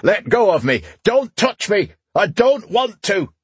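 high-pitched screamed protest; screaming. A narrator's reading. Knight being pulled away by a comrade, screaming. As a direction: scream it high, fighting off whoever holds you. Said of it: "he continued to scream".

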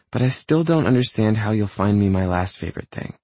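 high female-character voice, puzzled; girlfriend's voice. A narrator's reading. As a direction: raise the pitch for the puzzled girlfriend.

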